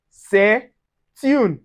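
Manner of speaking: "c'est une" as seh, tune; T of "c'est une" starts the next syllable; linked pronunciation. Shown as a demonstration. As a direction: pause after seh, then "une" with a T in "c'est une". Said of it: "pronounce the T together with the next vowel".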